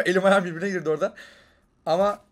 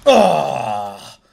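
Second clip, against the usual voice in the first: sharp loud cry of dismay; pained cry; 3 semitones lower, 13 dB louder than usual. Real, not acted. Gamer crying out as his character is shot down. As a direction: let out a sudden cry as you die. Player gets killed.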